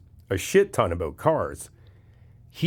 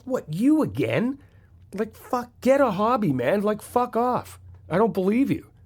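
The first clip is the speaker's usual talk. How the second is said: much higher, scoffing dismissal; mock exasperation; 11 semitones higher than usual. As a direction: brush off a pest, incredulous and annoyed. Mocking reply to bad guy.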